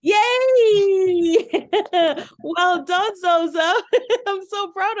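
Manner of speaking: high, loud delighted cheer with laughter; happy yay. Real, not acted. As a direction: squeal with joy at the right answer. Child answers quiz correctly.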